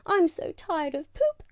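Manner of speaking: high, squeaky complaint. Acted. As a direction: squeak it like a small unicorn. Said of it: "she said with a squeak".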